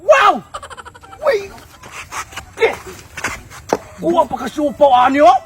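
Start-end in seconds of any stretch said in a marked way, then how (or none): 0.0-1.5 amazed wow. Real, not acted. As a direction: gasp it out, impressed by the size. Amazed at the big food.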